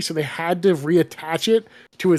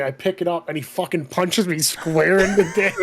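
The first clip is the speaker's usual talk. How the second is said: ordinary talk, nothing marked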